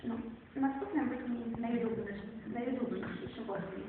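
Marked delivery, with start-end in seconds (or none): none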